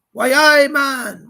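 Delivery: a hammed-up Geordie cheer; Geordie accent. Host tries a Geordie greeting for viewers, mock Geordie shout. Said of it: "Wait, no, that's Geordie".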